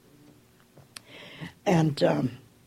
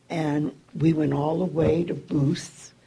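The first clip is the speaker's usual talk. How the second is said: ordinary talk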